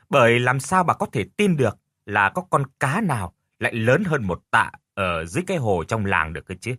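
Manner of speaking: usual speaking voice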